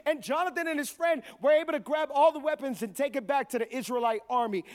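ordinary talk

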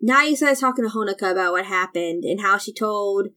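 ordinary talk, nothing marked

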